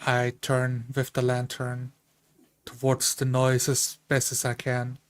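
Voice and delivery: normal talking voice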